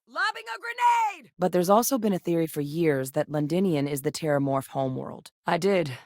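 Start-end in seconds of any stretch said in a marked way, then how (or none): 0.1-1.3 very high, urgent yell; high-pitched battlefield holler; combat callout. Acted. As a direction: shout the warning before the throw.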